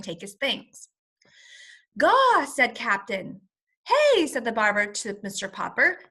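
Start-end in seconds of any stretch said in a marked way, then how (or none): 2.0-2.4 high, loud bird cry; penguin squawk. Acted. Penguin calling out in the barber shop, high and loud. 3.9-4.3 high, loud "Hey"; angry shout. Acted. Barber shouting at Mister Popper about the penguin.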